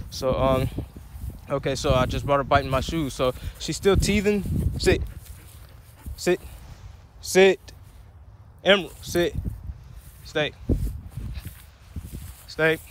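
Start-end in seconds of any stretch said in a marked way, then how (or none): 4.8-12.8 a sharp raised command to the dog; firm command. Real, not acted. Owner ordering her puppy to sit, firm raised command.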